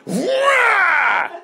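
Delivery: high, loud drawn-out wail; mock roar. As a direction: roar like the onrushing crew. Camera crew barreling at him.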